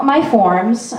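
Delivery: usual speaking voice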